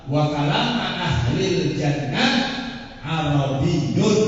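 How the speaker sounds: very slow chanted Arabic; measured hadith chant; slow recitation. Shown as a demonstration. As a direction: draw out each Arabic word.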